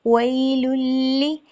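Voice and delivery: वैलुल्ली with the doubled ल held firm, slow; recited with doubled lam. Shown as a demonstration. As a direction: lean hard on the doubled ल in वैलुल्ली. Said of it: "लाम मुशद्दद है तो मजबूती आनी चाहिए". (वैलुल्ली में मुशद्दद लाम मज़बूती से)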